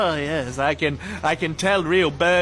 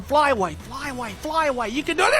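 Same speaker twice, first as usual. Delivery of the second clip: higher, rising cheer; 7 semitones higher than usual. Acted. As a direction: urge the bird to escape. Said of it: "cheering the bird on".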